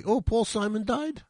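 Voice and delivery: ordinary talk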